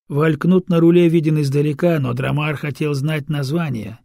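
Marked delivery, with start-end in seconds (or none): none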